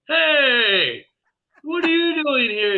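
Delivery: startled, delighted hail; surprised call. Retold or imitated. Medium greeting the spirit guide he recognizes, loud surprise. Seeing the jolly man again.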